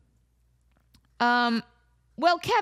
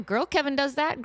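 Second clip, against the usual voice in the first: usual speaking voice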